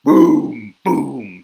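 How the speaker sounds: very loud booms; thunder booms. Acted. Reader reviews thunder for kids, booming loudly. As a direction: boom like thunder. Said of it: "Mister Brown makes thunder".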